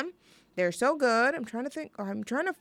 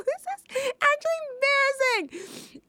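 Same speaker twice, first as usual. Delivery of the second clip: much higher, squeaky with embarrassment; embarrassed squeal. Real, not acted. Host squealing to listeners about an embarrassing moment. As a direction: let the voice jump up in mortified glee. Confessing an embarrassing story on air.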